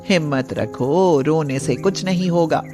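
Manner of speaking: a granny giving firm advice; wise old woman. Acted. (समझाती बूढ़ी अम्मा)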